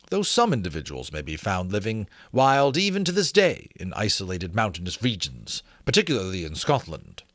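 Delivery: put-on measured, formal reading of an old treatise; formal reading aloud. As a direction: read it like a learned gentleman's letter. Reads textbook excerpt.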